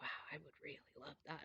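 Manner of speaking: a fast excited whisper, barely voiced; whispered wish. Son whispering his wish to mom in the store, hushed. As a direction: breathe it out like a kid sharing a secret.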